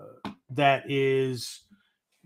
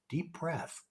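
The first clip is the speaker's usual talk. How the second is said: hushed deep breath; 3 semitones higher, 14 dB quieter than usual. Shown as a demonstration.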